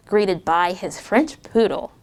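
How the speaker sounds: usual speaking voice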